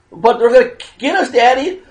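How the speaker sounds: lightly voiced frightened child begging daddy; mock kid whine; scared child. Child voiced in the same story.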